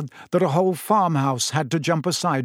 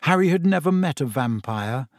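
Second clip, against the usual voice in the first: ordinary delivery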